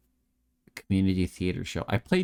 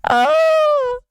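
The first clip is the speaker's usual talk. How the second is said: short high shrieking yelp; scream; 11 dB louder than usual. Shown as a demonstration. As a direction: let out a strangled squeal. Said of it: "the best I can do as far as screaming".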